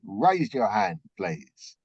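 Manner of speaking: ordinary talk, nothing marked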